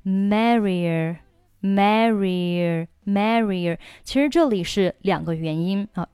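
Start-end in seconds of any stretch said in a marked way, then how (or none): 0.0-3.8 slow "merrier", Mary gliding into a weak are; slowly blended "merrier", no break between vowels; linked vowels. Shown as a demonstration. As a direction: join "merrier" with a y-glide, drawn out. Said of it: "可以连在一起".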